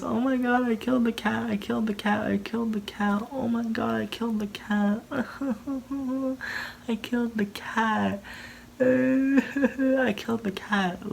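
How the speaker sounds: high-pitched mock wailing, sobbing between repeats; fake crying. [name] pretending she is upset about the cat, fake crying. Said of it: "she's all crying and faking and pretending".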